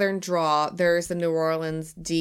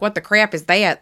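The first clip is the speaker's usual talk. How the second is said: louder, higher Alabama twang; 4 semitones higher, 7 dB louder than usual. Retold or imitated. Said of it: "like, Alabama twang".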